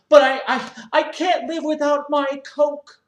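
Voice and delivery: high, loud self-righteous whine; whiny gym member. Acted. Member defends his mess to the owner, loud high whine. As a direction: complain like a spoiled man-child.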